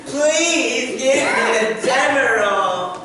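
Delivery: high, loud pleading shout; tearful pleading. Retold or imitated. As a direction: beg for the Demerol, wailing. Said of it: "she cried".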